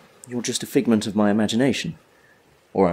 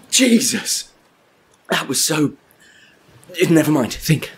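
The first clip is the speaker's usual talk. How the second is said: breathless, higher shaken voice; 5 semitones higher than usual. Acted. Man washed ashore, shaken and breathless. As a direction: still reeling from almost drowning. Shaken after near drowning.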